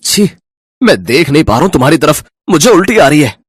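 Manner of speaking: loud, quick, low male voice; disgusted husband. Acted. Husband sees his bald wife, disgusted. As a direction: recoil from her in loud disgust.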